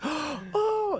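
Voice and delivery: imitated gasp into a very high "oh"; gasp, then squeak in shock; overwhelmed gasp. Assistant hearing the baby is coming, imitated overwhelmed gasp. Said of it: "she just got so overwhelmed".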